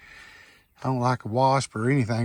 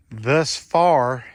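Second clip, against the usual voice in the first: usual speaking voice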